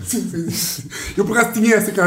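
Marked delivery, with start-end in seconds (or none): 0.1-0.9 laughing. Real, not acted. Laughing at the joke.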